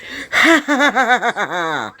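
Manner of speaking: high, long cackle tumbling downward; grand villain laugh. Acted. Nobunaga appearing in flames, boastful villain cackle. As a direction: laugh like a conquering demon king.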